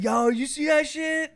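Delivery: a clerk hollering about the guy outside; excited clerk shout. Retold or imitated. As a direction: call it out like a worked-up store clerk. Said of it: "The clerk was like".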